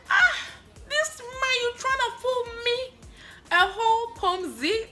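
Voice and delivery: big high sassy scoff; mocking call-out. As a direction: catch the scammer out and laugh at him. Calling out the scammer mockingly.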